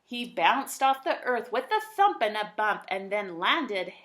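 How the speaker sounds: lilting rhymed read-aloud; bouncy rhyming narrator voice; storybook narration. A narrator's reading. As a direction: tell it like a bedtime picture book.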